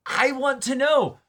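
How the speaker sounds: high, slow, whiny insistence; insistent high demand. Acted. Host mock-pleading to know the answer, slow and high. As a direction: drag it out up high like a needy fan.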